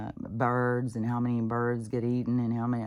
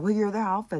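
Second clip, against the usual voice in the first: higher, louder imitation of mom; retold mom; 7 semitones higher, 5 dB louder than usual. Mom explaining the pets' dependence, mock matter-of-fact. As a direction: point it out in a raised, mocking mom voice. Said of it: "And she's like".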